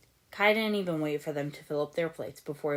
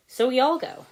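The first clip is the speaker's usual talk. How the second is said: shrugging; 9 semitones higher, 8 dB louder than usual. Acted. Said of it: "said Cole with a shrug".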